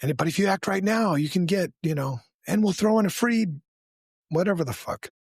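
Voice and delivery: high, eager TV sales pitch; infomercial pitchman. Acted. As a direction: sell it like a late-night infomercial. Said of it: "if we were good at Ron Popeeling".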